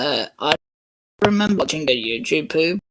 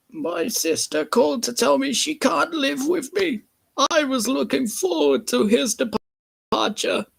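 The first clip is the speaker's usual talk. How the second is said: put-on high, odd old-lady voice; odd Missus Kindly voice; 13 semitones higher than usual. Missus Kindly telling of her sister, odd high voice. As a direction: pitch it up into a strange lady voice. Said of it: "That was an odd Missus Kindly voice".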